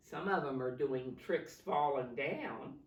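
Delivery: plain reading voice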